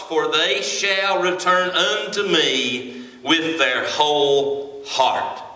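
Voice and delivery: slow, emphatic Bible verse; scripture reading. Real, not acted. Preacher reading God's promise of return, slow scripture reading. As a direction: draw the verse out deliberately for the congregation. Reads scripture aloud to congregation.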